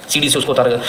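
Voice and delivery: usual speaking voice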